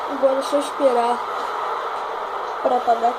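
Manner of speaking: loud relieved exclamation; relieved loud thanks. Real, not acted. Relieved, rain putting out fire.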